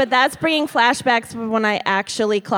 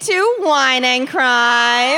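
slow announcer intro through laughter; podcast intro; 2 semitones higher, slower than usual. Acted. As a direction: open the show like a host, cracking up.

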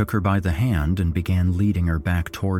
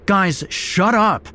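high, loud, drawn-out command; snapped; 14 semitones higher, 6 dB louder, slower than usual. A narrator's reading. Narrator cuts off the quarrelling friends, snapping. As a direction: cut them off angrily. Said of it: "I snapped".

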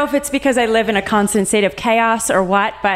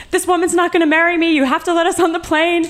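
higher and fast, a groom in a panic; mock frantic fit; 9 semitones higher, faster than usual. Fiancé frantically pleading with the gate agent, fast and high-pitched. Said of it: "Literally throwing a fit".

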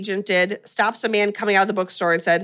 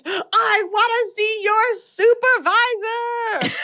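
mock scream; 11 semitones higher, much slower than usual. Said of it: "And John screams".

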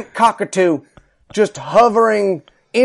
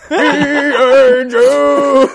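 mock cheerful shout; 7 semitones higher, 4 dB louder, much slower than usual. Insurgent thanking GI Joe.

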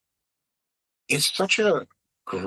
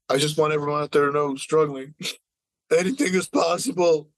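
hammed-up choked-up award winner, raised voice; mock tearful speech; 4 semitones higher, 4 dB louder than usual. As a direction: imitate a winner crying through an acceptance speech. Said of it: "like, crying".

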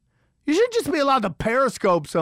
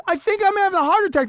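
higher, louder and fast; a frantic protest at someone filming him; exasperated outburst; 10 semitones higher, 4 dB louder, faster than usual. Retold or imitated. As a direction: fire it back in disbelief. Telling people filming him.

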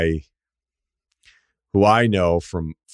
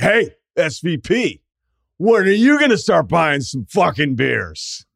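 imitating a pushy drunk fan; 8 semitones higher, slower than usual. Drunk fan heckling Van Pelt.

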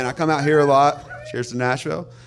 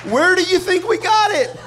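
higher, louder laughing question; laughing challenge to the crowd; 12 semitones higher, 6 dB louder than usual. Real, not acted. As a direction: toss it out laughing.

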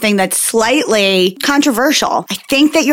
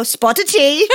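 mock British accent; 4 semitones higher, slower than usual. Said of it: "pull out on your back and go".

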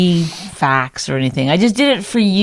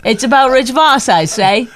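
higher mock newsboy cry; English-style paper seller; 5 semitones higher than usual. Co-host copies the newspaper call, mock English paper seller. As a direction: hawk the paper in hammed-up old-timey English. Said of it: "people from England in Chicago".

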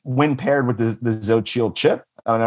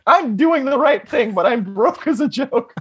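mock neoliberal shopper; 13 semitones higher than usual. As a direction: squeal it like a smug broke shopper. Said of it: "It's like".

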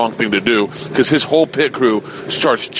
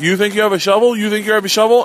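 taunting crowd; 10 semitones higher, 3 dB louder, faster than usual. Retold or imitated.

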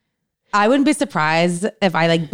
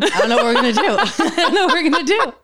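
higher, laughing through the words; 8 semitones higher, faster than usual. Real, not acted. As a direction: say it helplessly through giggles. Joking about drugging him, laughing.

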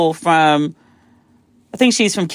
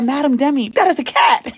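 higher-pitched mock-polite correction; strained straight face; 6 semitones higher than usual. Guide corrects panicked guest, strained straight-faced politeness. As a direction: imitate someone politely holding back a laugh. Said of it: "he tried to keep a straight face".

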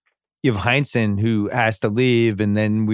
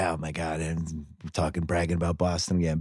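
much softer mock outsider grumble; a quiet, lower mocking aside as outsiders; lightly mocking the outsiders, much softer; 6 semitones lower, 8 dB quieter than usual. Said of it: "they're like".

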